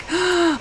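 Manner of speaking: sharp delighted intake of breath; excited gasp. Real, not acted. Player seeing the fence is done, excited gasp. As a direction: gasp on a sudden realisation.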